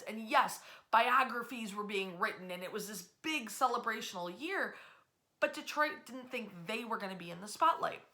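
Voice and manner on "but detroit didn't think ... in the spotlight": ordinary delivery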